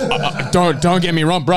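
ordinary delivery

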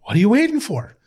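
high mock bark at a stalled player; yelling coach. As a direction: imitate an impatient coach hollering. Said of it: "Mason's yelling at him".

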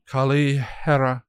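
rising chant, each name called out; incantation. Acted. Witch casting the binding spell, chanted. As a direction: cast the names like a spell. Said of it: "she incanted".

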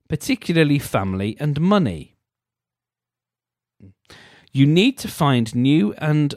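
mock-earnest reading; reading aloud. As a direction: read it like a horoscope entry.

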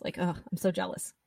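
ordinary talk

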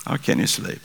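normal talking voice

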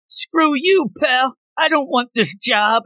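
high-pitched mock refusal; defiant candidate. Acted defiant job interview answer.

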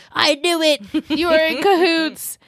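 high, sharp indignant gotcha; indignant accusation. Retold or imitated. Rufus barging in on Lily and Bart, indignant.

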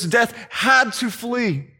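usual speaking voice

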